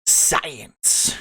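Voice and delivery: breathy whispered word. Pretend. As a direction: breathe it out in a hush. Whispered science.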